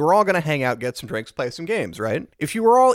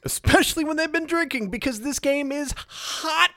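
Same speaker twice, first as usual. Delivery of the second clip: much higher-pitched exasperated echo; 13 semitones higher than usual. Real, not acted. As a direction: push it way up high, driving the complaint home. Echoes co-host in exasperation.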